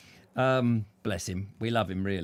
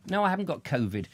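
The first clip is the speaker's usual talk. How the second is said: ordinary talk, nothing marked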